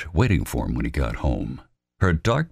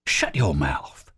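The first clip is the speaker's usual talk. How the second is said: slow, hushed whisper snap; irritable; slower than usual. Acted. Albert hushing Robert Lee, irritable whisper. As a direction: shush him sharply. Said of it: "Albert said irritably".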